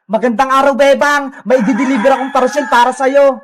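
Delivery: low-pitched courier character voice; delivery rider. Acted. Rider announcing a parcel for Bebang, low character voice.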